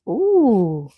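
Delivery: ordinary talk, nothing marked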